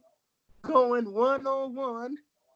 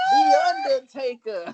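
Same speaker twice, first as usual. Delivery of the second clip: booming ring announcer call; announcer shout; 8 dB louder than usual. Pretend. Announcer-style wrestling callout.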